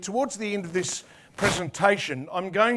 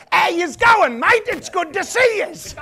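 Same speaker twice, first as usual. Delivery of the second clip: much higher, louder broad Australian with "yous"; broad Aussie; 11 semitones higher, 7 dB louder than usual. Shown as a demonstration. Preacher teaching the crowd Aussie slang, broad Australian. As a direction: ham up the ocker Australian "How yous going, mate". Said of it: "you can say".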